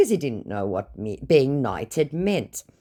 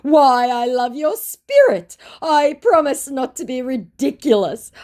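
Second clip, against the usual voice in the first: higher, slow flattery; sugary fake promise; gushing scammer; 12 semitones higher, 6 dB louder than usual. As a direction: scammer praising her spirit, high and slow. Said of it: "my scammer says".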